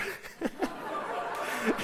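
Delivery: high breathless laugh bursting out; can't hold in the laughter; real laugh. Real, not acted. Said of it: "I'm just laughing".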